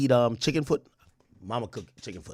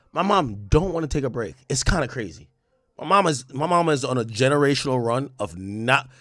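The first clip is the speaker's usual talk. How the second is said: ordinary delivery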